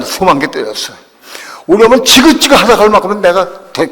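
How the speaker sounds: loud, high, desperate plea to the Lord; acted anguished outburst; retold weeping prayer. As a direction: cry out in re-enacted helpless protest. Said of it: "내가 울면서 그렇게 기도했어요".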